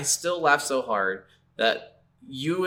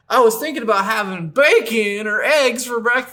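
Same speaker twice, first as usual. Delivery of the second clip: high, louder goofy character voice; silly game character; 10 semitones higher, 6 dB louder than usual. Pretend. Cohost mocking every character in the game, high goofy voice. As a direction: ramble like a clueless side character.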